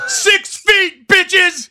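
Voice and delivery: high loud shout; mock yell. Cohost joining the yelling at the donut line. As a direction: yell it out the car window.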